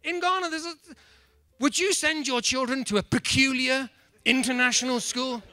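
normal talking voice

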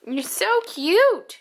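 high, slow, drawn-out coo. Real, not acted. Mother lingering over her baby, slow and high. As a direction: stretch it out lovingly and slowly. Same words said twice.

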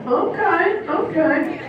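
ordinary delivery

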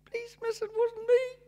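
mock the boy's excuse in a high little voice; teacher mimicking the pupil's whine; mocking child voice.

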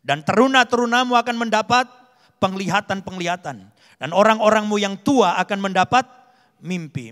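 loud, emphatic Bible reading; scripture proclamation. A narrator's reading. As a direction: proclaim the verse to the congregation. Reads scripture aloud with force. (membacakan firman dengan lantang)

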